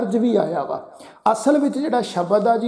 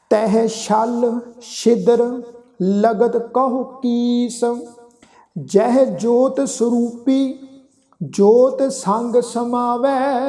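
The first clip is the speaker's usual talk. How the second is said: slow, measured chanting of sacred verse; slow scripture recitation; 3 semitones higher, much slower than usual. Acted. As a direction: draw each word out reverently.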